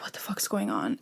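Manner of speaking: soft whisper of confusion, retold; whispered disbelief. She retells asking family about the package, whispered. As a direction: breathe the question out in a whisper. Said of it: "I'm like".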